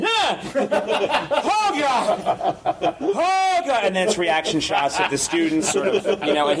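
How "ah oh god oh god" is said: high, startled mock scream; hammed-up fright, pitched way up; scared professor. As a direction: yelp in sudden comic terror. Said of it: "he gets just as scared each time".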